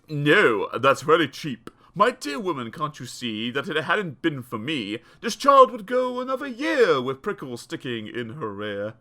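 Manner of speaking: low, smug dentist voice; dentist's deep voice replying to mother; dentist answering. Acted. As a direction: brush off the complaint. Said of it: "He answered".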